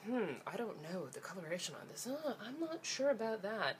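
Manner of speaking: half-hearted impression of a doubtful doctor; mock doctor. Mocking the Japanese check-up doctors in a light fussy doctor voice.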